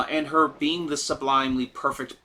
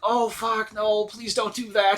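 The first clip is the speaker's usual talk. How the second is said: higher, slow pleading dismay; mock dismay; 8 semitones higher, slower than usual. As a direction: cringe and beg them to stop. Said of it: "And it's like".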